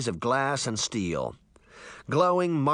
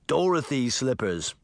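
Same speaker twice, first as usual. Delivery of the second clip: usual speaking voice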